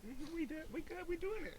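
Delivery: high, soft, urgent aside; hushed eager agreement. Retold or imitated. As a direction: quietly overrule a friend and say yes. Overruling hesitant friend, quickly accepting.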